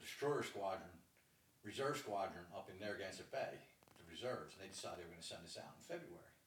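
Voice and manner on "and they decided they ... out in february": ordinary talk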